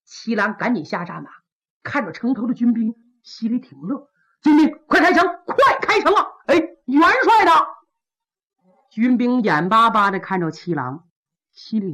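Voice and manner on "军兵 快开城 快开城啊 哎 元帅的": loud urgent call up to the wall. Acted. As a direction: shout up to the gate guards, higher and louder. Qilang calling up to city wall. (向城头高声急喊)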